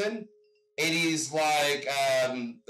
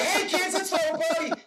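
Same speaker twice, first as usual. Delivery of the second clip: high-pitched hammed-up old movie star rallying the kids; mock showbiz call to the gang, pitched way up; excited, high put-on voice with a laugh in it; Mickey Rooney; 12 semitones higher than usual. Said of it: "It's like Mickey Rooney".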